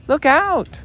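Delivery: high, loud warning; warning cry. A narrator's reading. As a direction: shout a warning. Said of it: "cried Christopher Robin".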